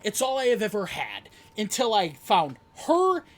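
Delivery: yelled hard at full volume; angry shout. Real, not acted. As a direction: shout it out in raw fury. Wrestler yelling during heated promo.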